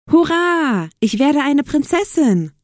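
high, gleeful stepsister; excited cheer. A narrator's reading. Stepsister who got the ball invitation, cheering excitedly. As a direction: cheer with a long high hurrah.

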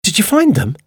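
very high, eager surprised question. A narrator's reading. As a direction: ask in high-pitched amazement.

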